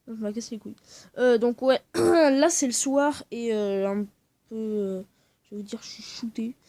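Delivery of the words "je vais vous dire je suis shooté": sleepy whispered confession. Real, not acted. Telling viewers he is worn out, whispering. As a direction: whisper it like a secret.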